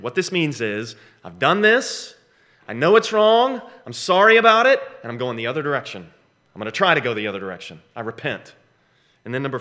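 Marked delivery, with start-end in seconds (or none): 1.2-4.8 louder and higher with each clause; rising, forceful preaching; building emphasis. Real, not acted. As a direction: build it up toward sorry about it.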